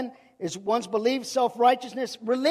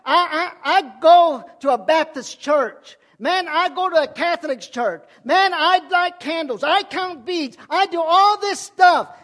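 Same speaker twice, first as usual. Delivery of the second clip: loud, high mocking churchgoer bragging; mock religious boaster; 6 semitones higher, 10 dB louder, slower than usual. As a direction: belt it out like a smug churchgoer listing deeds. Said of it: "this is religion saying".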